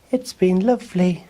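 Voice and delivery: put-on high boy voice, bravely cheerful; Oliver putting on a smile. A narrator's reading. Oliver telling Ruby about Patch, brave high boy voice. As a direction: smile through the sadness. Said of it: "trying hard to smile back".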